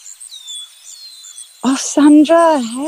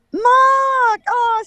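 excited call; 7 semitones higher, 4 dB louder than usual. Acted.

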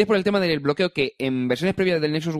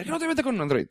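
imitated critic; 9 semitones higher, 3 dB quieter, faster than usual. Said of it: "Después dices".

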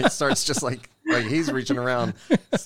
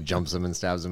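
ordinary talk

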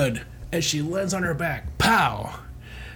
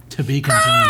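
much higher and louder cliffhanger cry; dramatic announcer; 6 dB louder than usual. Acted. Host ending the comic reading with a booming cliffhanger call. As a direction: belt the cliffhanger like a trailer voice.

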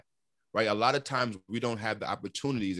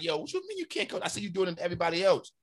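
higher-pitched, put-out pushback; retold indignant protest; 8 semitones higher than usual. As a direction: protest in disbelief, voice jumping up. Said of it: "I felt a certain kind of way".

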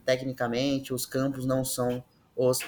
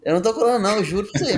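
playful protest; 6 semitones higher, 4 dB louder, much faster than usual. Real, not acted. Teasing accusation of cheating.

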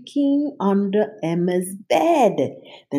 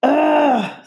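disgusted monster snarl, higher and louder; 10 semitones higher, 6 dB louder than usual. A narrator's reading. Cynthia annoyed at the bracelets, snarling. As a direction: spit it out in disgust. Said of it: "Cynthia snarled".

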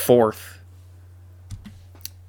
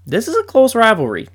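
much higher, louder declaration; emphatic insistence; 11 semitones higher, 5 dB louder than usual. Real, not acted. Fan telling doubters the rivalry is close, emphatic. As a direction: proclaim it so nobody can argue.

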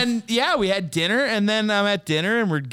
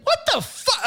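incredulous outburst. Retold or imitated. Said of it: "he's like".

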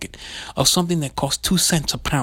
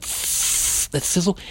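hissing sizzle; 3 semitones higher, much slower than usual. Shown as a demonstration. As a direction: draw out a slow, hissed sizzle. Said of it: "you see how the sound is".

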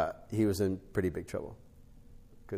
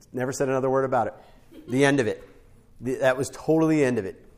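emphatic; 4 semitones higher, 6 dB louder, faster than usual. Real, not acted. Preacher emphasising the lesson.

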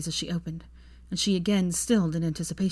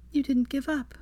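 higher, proud and hushed; Hermione, proud whisper; 8 semitones higher than usual. A narrator's reading. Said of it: "she whispered proudly".

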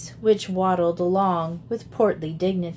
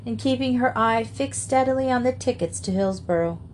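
plain reading voice

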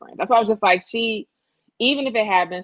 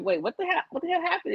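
higher, startled friend asking what happened; a friend's stunned disbelief, pitched up; shocked friend; 10 semitones higher, 3 dB quieter, faster than usual. Retold or imitated. Friend hearing about the ass shots, shocked and pitched up. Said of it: "her friend is like".

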